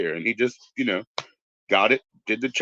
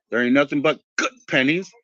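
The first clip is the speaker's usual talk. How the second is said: normal talking voice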